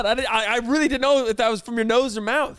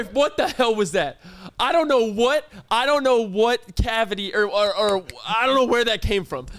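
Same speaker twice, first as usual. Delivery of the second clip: ordinary talk, nothing marked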